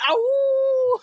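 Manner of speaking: high loud wolf howl; mock howl. Kids howling at the substitute teacher, high and loud. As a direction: howl like a rowdy kid.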